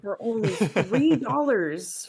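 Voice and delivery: mock sales pitch. Joking sales pitch for Patreon.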